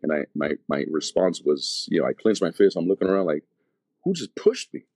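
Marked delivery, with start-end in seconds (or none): 4.0-4.8 high-pitched retold bewilderment; startled disbelief. Man on the floor looking around for who pushed him, startled. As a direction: act out a startled question, pitched way up. Reenacting being knocked down, puzzled.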